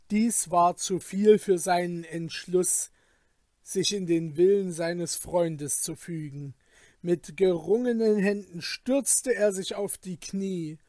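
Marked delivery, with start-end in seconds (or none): none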